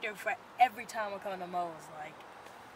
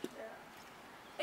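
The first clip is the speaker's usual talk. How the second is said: ordinary talk, nothing marked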